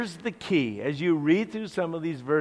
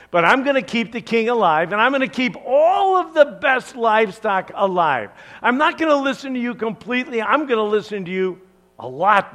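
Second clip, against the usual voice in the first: fast, louder, smug self-talk of a scheming king; higher, quick and self-satisfied bargaining; Saul's inner voice; 5 semitones higher, 7 dB louder, faster than usual. Acted. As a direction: rattle off excuses to yourself, pleased with the plan. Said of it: "In Saul's, well, mind".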